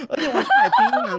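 high-pitched laughing burst; 槟榔 blurted out high through laughter. Real, not acted. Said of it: "你们知道我还去买槟榔吗". (笑着拔高声音说)

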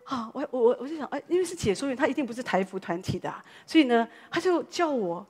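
ordinary talk

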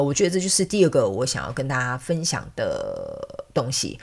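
ordinary delivery

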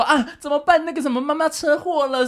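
high-pitched imitation of a distraught caller; scammer's fake panic. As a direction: put on urgent alarm to fool the listener. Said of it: "一打来就塑造紧张感".

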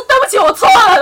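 大声夸张地道歉; 下跪时高声喊出; 夸张道歉. Retold or imitated. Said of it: "对我下跪". (夸张大声地认错)

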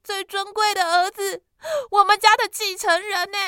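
带哭腔高声哀叫; distraught crying. Acted. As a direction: wail it high through tears. 母亲惊慌哭喊. (妈妈带着哭腔惊慌地喊)

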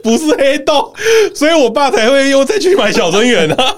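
high, laughing wail through the punchline. Real, not acted. Host finishing the braised-food story to co-hosts, laughing. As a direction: crack up while finishing the story.

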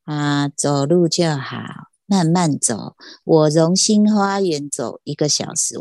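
slow, low-key impression of a director giving advice; slow-talking hospital director. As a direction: imitate an unhurried doctor, words drawn out. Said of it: "那个院长讲话也是这样".

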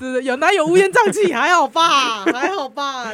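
loud protest. Real, not acted. Host loudly denies chaos.

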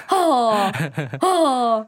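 a short, gruff "吼" thrown back in annoyance; mock his irritated huff; imitating a grumpy grunt; impatient grunt. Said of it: "他就这样，吼".

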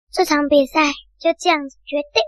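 usual speaking voice

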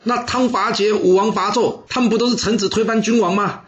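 high, heated pleading that slows on the last question; agitated protest. Acted. Wu Zixu protests to the king, voice high and agitated. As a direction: argue hotly, pitch climbing with frustration. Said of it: "他激动地说".

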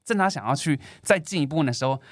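normal talking voice

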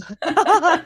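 bursts out laughing high; a big embarrassed laugh; sudden high laugh at herself; loud laugh. Real, not acted. Embarrassed about changing topic.

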